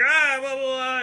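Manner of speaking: high-pitched mock drunk blather; babbling drunk. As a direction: imitate a drunk rambling noise. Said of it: "you know, drunk guy".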